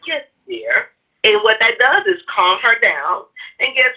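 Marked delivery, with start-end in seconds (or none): 0.0-0.9 higher, softer yes dear; imitating a placating dad; meek husband. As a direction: soothe an upset wife gently. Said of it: "my dad would be like".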